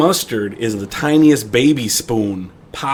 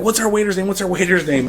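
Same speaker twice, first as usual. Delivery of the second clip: mock panic; 7 semitones higher, faster than usual. Burning friend frantically asks, acted.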